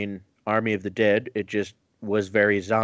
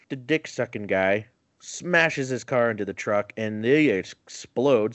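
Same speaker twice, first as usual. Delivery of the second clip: ordinary talk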